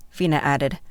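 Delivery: ordinary delivery, nothing marked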